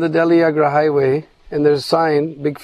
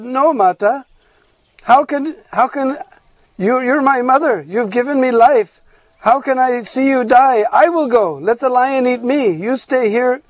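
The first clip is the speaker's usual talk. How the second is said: higher-pitched, insistent calf protest; pleading calf; 6 semitones higher than usual. Acted. Calf offers itself to save its mother, pleading.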